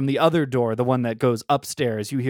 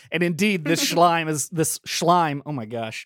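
usual speaking voice

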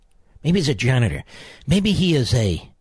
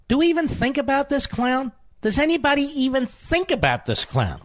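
much higher, mock-incredulous voice; incredulous rant; 9 semitones higher than usual. Real, not acted. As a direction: pitch way up in scornful disbelief. Host's mock-incredulous rant at guest.

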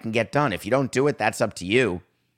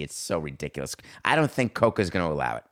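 usual speaking voice

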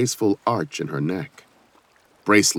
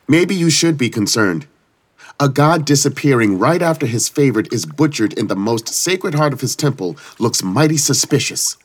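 louder, higher accusing tone; challenging; 7 semitones higher, 5 dB louder than usual. Acted. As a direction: confront the goddess head-on. Iris challenges the goddess.

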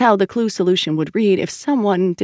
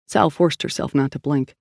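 plain reading voice

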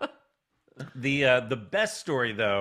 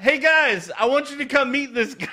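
much higher and louder, calling across a room; excited call out; 12 semitones higher, 6 dB louder than usual. Retold or imitated. Host voicing wrestler calling fellow wrestlers over, excited call. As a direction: hail the whole crew with glee.

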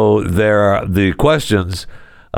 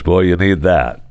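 usual speaking voice

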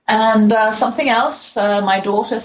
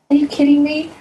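higher, mock disbelief; incredulous; 8 semitones higher, 3 dB louder than usual. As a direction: play it like you can't believe your ears. Incredulous reaction to lady's question.

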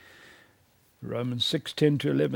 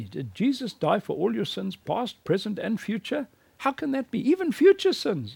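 incredulous objection; 9 semitones higher than usual. Retold or imitated. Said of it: "Muslims say to me".

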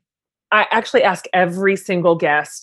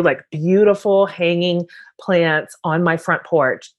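ordinary delivery

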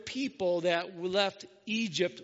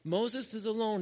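ordinary talk

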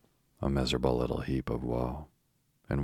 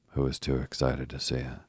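ordinary delivery, nothing marked

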